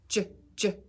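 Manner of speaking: "j, j" as a soft g, like in giraffe; teacher modelling the soft g sound. Shown as a demonstration. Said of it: "Now let's do the soft sound".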